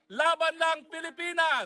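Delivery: rally cheer. Acted. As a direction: cheer the crowd on.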